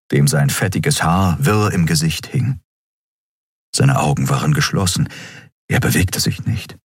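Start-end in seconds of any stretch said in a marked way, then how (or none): none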